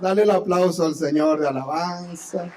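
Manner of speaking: loud call for applause; rally the congregation loudly; preacher urging applause, loud. Real, not acted.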